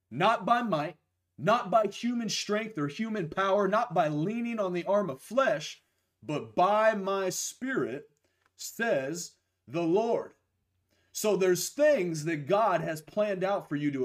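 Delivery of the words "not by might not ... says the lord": slow, rising scripture proclamation; slow emphatic scripture reading. Acted. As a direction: proclaim each phrase slowly.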